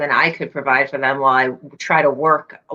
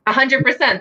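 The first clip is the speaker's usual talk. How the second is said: high-pitched, fully voiced repeat; emphatic agreement, said up clearly; 8 semitones higher than usual. Real, not acted. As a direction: repeat it clearly for someone who missed it. Repeats after not being heard.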